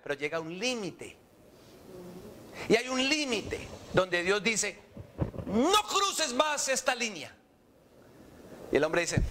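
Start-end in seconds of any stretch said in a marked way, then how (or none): 5.5-7.3 slow, raised commanding voice; stern warning. Acted. Preacher voices God setting a limit, stern warning. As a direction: lay down a final limit, word by word.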